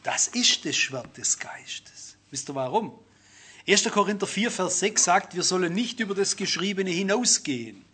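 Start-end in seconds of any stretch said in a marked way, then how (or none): 0.0-3.0 „das Schwert des Geistes“ tief, langsam und geflüstert, Betonung auf „ist“; ernst geflüstert. Shown as a demonstration. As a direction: gewichtig gehaucht statt spielerisch. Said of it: "man sagt immer so ein bisschen spielerisch". (leise, langsame, ernste Flüsterstimme)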